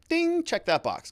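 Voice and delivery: very high, drawn-out ding; playful high tally call; mock scoring chime. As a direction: sing out a point scored, slowly. Mimes defense scoring a point.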